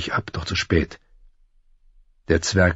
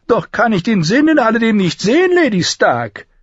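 high, loud, scoffing dwarf; mocking; 5 dB louder than usual. A narrator's reading. Dwarf scoffs at the lady's fine friends, high and mocking. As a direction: sneer at her with pitched-up admiration. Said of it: "spöttelte Lannister".

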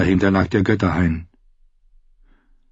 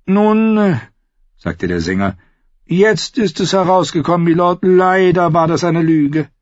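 high, smooth mock-polite outlaw singer; sweetly polite while threatening; silky false courtesy; 10 semitones higher, 4 dB louder than usual. Singer figure voiced.

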